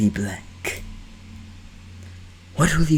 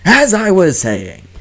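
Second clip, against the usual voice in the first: louder, pointed restart; 10 semitones higher, 10 dB louder than usual. Acted. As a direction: reclaim the floor firmly. Loud restart after coughing fit.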